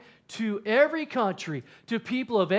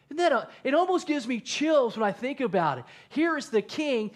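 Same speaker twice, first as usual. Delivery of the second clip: ordinary delivery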